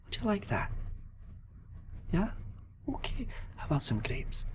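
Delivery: high, soft, sing-song offer; gentle offer. Acted. Paladin offering the girl food, high and soft. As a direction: tempt a shy child kindly.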